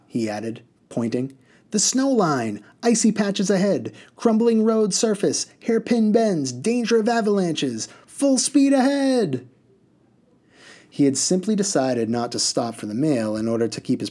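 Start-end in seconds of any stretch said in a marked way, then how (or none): 1.7-7.9 high, loud, excited listing; gleeful hazard list. Acted. Driver pointing out hazards. 8.0-9.5 very high, slow, loud shout; rallying cry. Acted.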